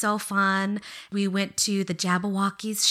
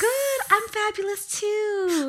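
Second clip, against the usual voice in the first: high squeaky voice; 12 semitones higher than usual. Acted.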